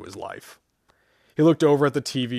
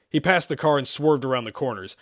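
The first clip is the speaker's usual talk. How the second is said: ordinary delivery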